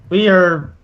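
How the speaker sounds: ordinary talk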